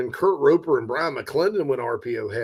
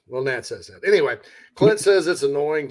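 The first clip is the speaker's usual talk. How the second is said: ordinary delivery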